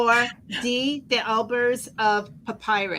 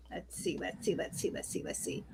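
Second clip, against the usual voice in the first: whispering; 4 semitones lower, 16 dB quieter than usual. Real, not acted. Host muttering while reading card.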